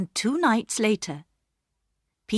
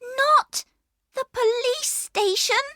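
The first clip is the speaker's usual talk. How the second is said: put-on much higher, fearful girl's question; alarmed cry; 3 dB louder than usual. A narrator's reading. Another sister fearing the police station, high and alarmed. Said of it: "cried another voice".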